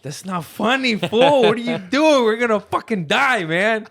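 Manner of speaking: terrified passenger yelling at the driver; panicked yell. Retold or imitated.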